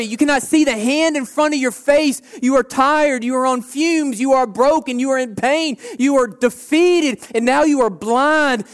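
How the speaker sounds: loud, high, impassioned preaching; preaching at full force. Acted. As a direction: pound the congregation with each line. Preacher describes darkness in Egypt.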